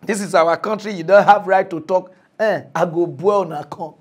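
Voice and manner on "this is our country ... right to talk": loud, mocking the arrogant speakers; loud imitation of "this is our country" talkers; mocking quote. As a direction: throw their words back, louder. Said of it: "those of una wey dey talk say".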